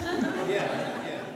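a short airy laugh; breathy laugh. Real, not acted. Preacher laughing at the king's foolish plan, airy chuckle. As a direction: laugh it off under your breath.

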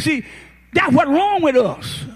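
normal talking voice

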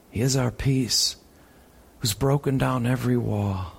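ordinary delivery

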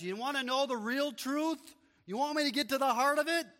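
Jesus challenging his critics, fast and loud; rising proclamation. Acted. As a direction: voice Jesus throwing down the gauntlet. Preacher voicing Jesus, building up.

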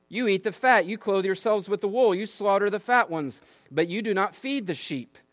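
reading God's rebuke with rising force, high and loud; fervent scripture reading. Acted. Pastor reads Ezekiel's rebuke to the congregation, preaching with force. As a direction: proclaim it like a prophet thundering at the shepherds.

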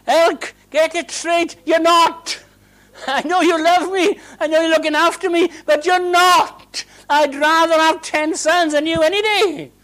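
high, loud exasperated wife; Hannah retorting. Acted. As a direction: snap back at your well-meaning husband. Hannah answers Elkanah.

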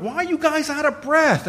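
mock the boss's suspicious demand; a boss grilling the workers about why they're winded. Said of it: "he's like".

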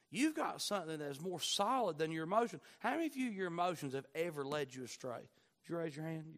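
ordinary talk, nothing marked

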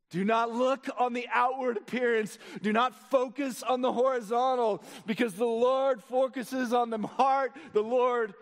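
impassioned preaching. Real, not acted. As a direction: press the congregation with rising conviction. Preaches at full force.

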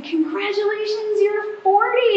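high, slow, teasing mother-in-law; a mild mock of her cheery mother-in-law; lightly voiced mother-in-law. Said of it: "And she said".

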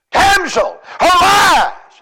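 high, loud cry of command; commanding shout. Acted. Jesus calling the little girl to rise, commanding shout. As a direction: call her back from death.

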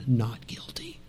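breathy whispered Not guilty, very soft and hushed; whispered verdict. Acted. Jesus answering the accusers, whispered.

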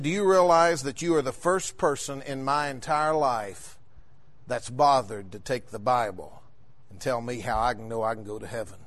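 low, soft, slowing to a hush; hushed retold confession. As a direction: quietly quote a man's earnest words. Quotes Travis's moved statement to him.

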